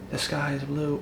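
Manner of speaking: said softly and high, sweetly; soft high sweet take of a plain fact. Shown as a demonstration. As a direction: say it nice and gentle. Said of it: "Does it change if I say".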